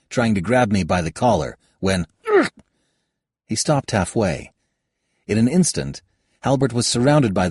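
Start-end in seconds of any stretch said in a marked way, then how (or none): 2.3-2.5 strangled high grunt; choked grunt. Acted. Halbert gets seized mid-lunge and chokes out a grunt.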